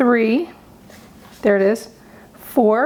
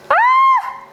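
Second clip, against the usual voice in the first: high, sudden startled scream; scared shriek; 3 dB louder than usual. Real, not acted. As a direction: yelp in real fright. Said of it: "I'm scared".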